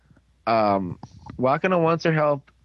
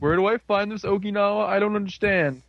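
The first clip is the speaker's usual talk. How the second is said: high-pitched helpless confusion; mock bewilderment; 6 semitones higher than usual. Person who can't figure out how to get there, acted confusion. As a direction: play hopelessly lost in a raised voice.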